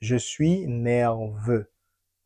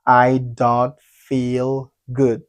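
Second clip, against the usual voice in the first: "I don't feel good" slow, each word separated; slow teaching demonstration; much slower than usual. Shown as a demonstration. Teacher answering how to say it, slow and separated. Teacher models phrase for learners.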